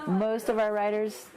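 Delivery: normal talking voice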